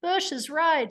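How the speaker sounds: walk the witness along in small, punchy steps; Vinny. Retold or imitated. Lawyer stepping a witness through photos, lightly imitated cross-examiner. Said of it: "the way Vinny did".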